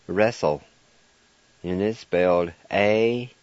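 usual speaking voice

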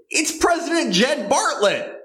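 jumps way up in pitch for the reveal; dramatic reveal. Acted. Writer reading his script, announcing the reveal with excitement. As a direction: announce the twist like a cliffhanger.

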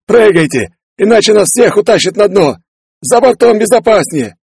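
high, panicked yelling; urgent shouting. Acted. Crew urges everyone overboard, urgent shouting.

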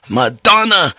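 a man breaking under guilt; tormented outburst. Acted. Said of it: "he burst out in torment".

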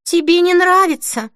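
high, taken-aback asking; dismayed question. A narrator's reading. Denise learning Holly dislikes the dress, dismayed. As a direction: ask it high, in disbelief.

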